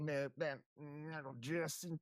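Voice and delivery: a hammed-up Southern accent, slow; put-on Southern accent. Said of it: "Bubba's Southern maga country accent".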